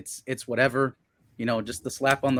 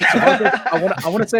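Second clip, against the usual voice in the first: laughing through the name; cracking up as he calls out; 8 semitones higher, 8 dB louder, faster than usual. Real, not acted. Calling out to Cervantes on stream, laughing.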